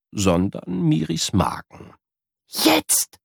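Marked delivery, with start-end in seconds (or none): 2.5-3.2 high sharp hissed cue; a touch of a high hiss. Acted. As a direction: signal the dash. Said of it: "zischt sie".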